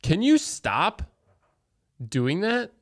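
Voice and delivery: slow, exasperated request to stop; annoyed. Real, not acted. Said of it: "you mean it's irritating you?".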